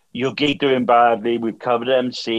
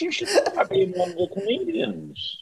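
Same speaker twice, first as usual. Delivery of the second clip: lightly mimicked, high-pitched praise; mock the well-meaning punter; imitating audience member; 8 semitones higher than usual. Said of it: "I still".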